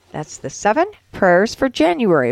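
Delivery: plain reading voice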